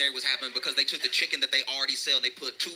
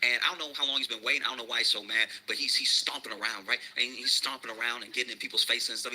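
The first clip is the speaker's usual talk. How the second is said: ordinary delivery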